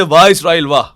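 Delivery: loud, emphatic bravo; acted loud acclaim; open cheering praise. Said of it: "करने का अंदाज और है".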